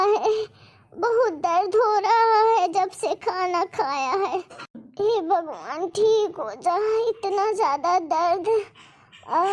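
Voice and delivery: put-on slow, groaning old woman with a stomach ache; old mother in pain. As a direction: moan each word as if the pain won't stop. Sick mother-in-law groaning in pain. (धीमी, कराहती बूढ़ी सास की आवाज़)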